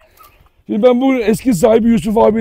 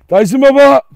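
raised, higher greeting call; 6 semitones higher, 6 dB louder than usual. Real, not acted. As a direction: call out loudly to the dog. Greets the dog by calling out. (yüksek sesle seslenme)

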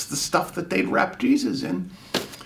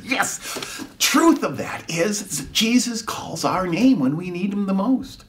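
ordinary talk, nothing marked

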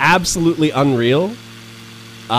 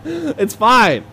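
high laughing dismissal; 11 semitones higher than usual. Real, not acted. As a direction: laugh it off, shrugging. Laughs off near collision.

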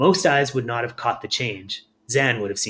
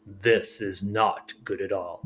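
put-on lower, slow troubled old creature; slow glum Glurk; 5 semitones lower, 4 dB quieter, slower than usual. A narrator's reading. Glurk surveying the messy house, slow low dismay. Glurk voiced looking at mess.

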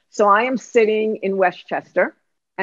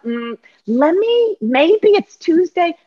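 higher, slow, dithering; high hesitant voice; 8 semitones higher, 4 dB louder, slower than usual. Retold or imitated. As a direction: stall nervously in a higher voice. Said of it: "here's what it'll sound like".

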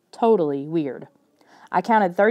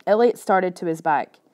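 ordinary delivery, nothing marked

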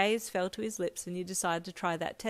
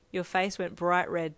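ordinary delivery

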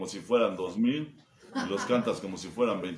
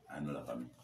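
much softer, lower aside; embarrassed mutter; 10 semitones lower, 11 dB quieter than usual. Retold or imitated. Embarrassed before the godfather's crowd, quiet and low. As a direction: mumble it, ashamed. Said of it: "y yo".

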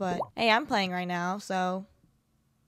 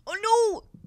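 high loud oh no; dismayed cry; 11 semitones higher, 5 dB louder than usual. Real, not acted. Player seeing a friend leave, a high dismayed cry.

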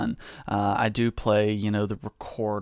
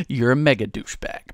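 ordinary talk, nothing marked

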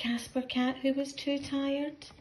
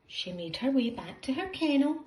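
ordinary delivery, nothing marked

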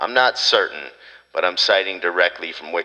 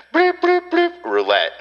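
censor bleep sound; slower than usual. Shown as a demonstration. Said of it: "we could say that word".

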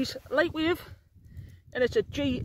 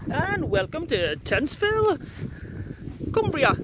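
mock presenter intro; 3 semitones higher, slower than usual.

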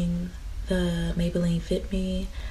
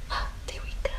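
whispering; 8 dB quieter than usual. Real, not acted. Hushed cooing at the baby.

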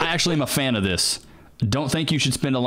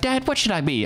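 higher-pitched son asking dad; higher voice for an imagined son; son's voice; 8 semitones higher than usual. Acted. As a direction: ask lightly as the son, pitched up. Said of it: "if I had a son".

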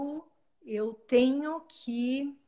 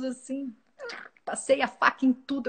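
usual speaking voice

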